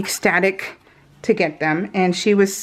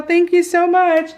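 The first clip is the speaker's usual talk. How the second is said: higher, light mother-in-law voice; a hint of a gushing mother-in-law; imitating mother-in-law; 8 semitones higher, 3 dB louder than usual. Mother-in-law thanking for the gift, lightly mimicked higher. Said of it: "She's all".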